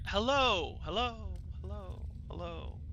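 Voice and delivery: fading repeated hellos; echo. Acted. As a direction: let each hello fade away like an echo. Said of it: "Kind of a normal echo".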